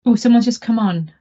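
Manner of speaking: normal talking voice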